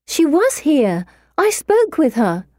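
high, emphatic insistence; insistent protest. A narrator's reading. Narrator insists to doubting friends.

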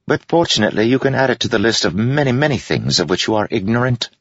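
high, fast and breezy; said lightly. A narrator's reading. Jester insults the general, high, fast and light. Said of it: "Wit said lightly".

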